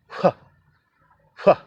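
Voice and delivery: huh, a breathy h replacing fa; breathy h sound. Shown as a demonstration. Preacher demonstrating the Japanese h for f. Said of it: "Instead of fa".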